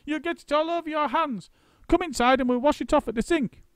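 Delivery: put-on high, sneering old woman; a nasty old wife's cackling voice; shrill Missus Twit. A narrator's reading. Missus Twit sneering to husband about the monkeys.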